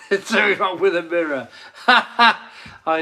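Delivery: laughing through the words; real laughter at a comment. Real, not acted. Host reading a viewer's joke, laughing.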